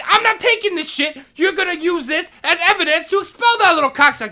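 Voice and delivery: defiant yell. Retold or imitated. Janitor refusing the hard drive, defiant yell. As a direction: shout the refusal. Retold shouting match with principal.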